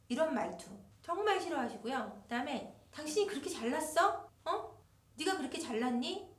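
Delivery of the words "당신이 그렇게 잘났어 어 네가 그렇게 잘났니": 네가 stressed, rising mock sneer; sneering taunt. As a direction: belittle him with a sarcastic rise. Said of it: "이렇게 하면은".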